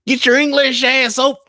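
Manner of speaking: a second try at a Southern guy that falls apart; failed Southern voice. Retold or imitated. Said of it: "I'm fucking it up".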